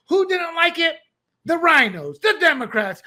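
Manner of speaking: ordinary delivery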